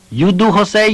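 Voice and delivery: high, threatening wife. A narrator's reading. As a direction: warn him menacingly. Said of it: "his wife Nancy threatened".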